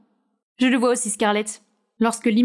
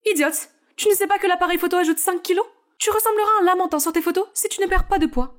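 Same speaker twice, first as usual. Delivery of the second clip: higher-voiced character, berating and mocking; scolding coach; 6 semitones higher than usual. Coach berates Scarlett over her strict diet, higher scolding voice. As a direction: scold the starving trainee.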